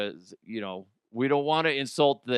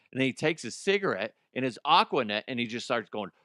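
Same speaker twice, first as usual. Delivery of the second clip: ordinary talk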